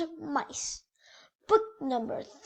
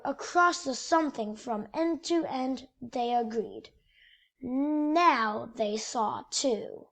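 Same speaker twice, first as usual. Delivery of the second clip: lively picture book storytelling voice; reading to children; 2 semitones lower than usual. A narrator's reading. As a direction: read it like bedtime story time for kids. Reads picture book aloud.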